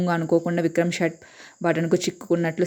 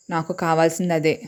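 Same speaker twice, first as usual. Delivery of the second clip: plain reading voice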